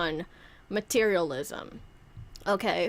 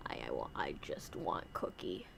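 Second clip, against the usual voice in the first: low breathy whispered puppet voice, much softer; creepy puppet whisper; 14 dB quieter than usual. Pretend. Puppet Grumster whispering to Santa. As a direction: whisper it like a sinister little puppet.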